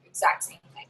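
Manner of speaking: "Exact same thing" whispered with no voice; whispered aside. Real, not acted. Repeats agreement under breath.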